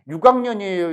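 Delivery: 육 학년이에요 lenis Jeolla style; 육 학년이에요, Jeolla pronunciation. Shown as a demonstration. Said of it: "이렇게 말하지".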